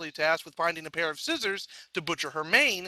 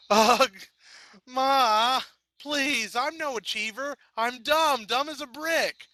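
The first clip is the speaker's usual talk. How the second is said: high, whiny protest; whining teen; 6 semitones higher, slower than usual. A narrator's reading. Daughter whining at mother.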